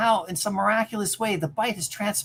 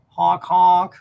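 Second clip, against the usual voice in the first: mock car horn; 8 dB louder than usual.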